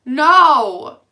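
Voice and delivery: loud, high, forceful correction; emphatic rebuttal. Real, not acted. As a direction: shout down the judgment, slowing at the end. Defending mothers with emphatic rebuttal.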